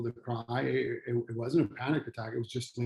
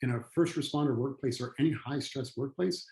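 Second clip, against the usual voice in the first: ordinary talk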